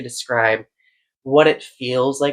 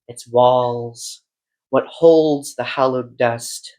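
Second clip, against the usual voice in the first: slow, measured recital with lingering line ends; incantatory, deliberate poem delivery; poetry reading; 3 dB louder, much slower than usual. Acted. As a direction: read it as a poem, drawing out each phrase and pausing between images. Reads a poem aloud.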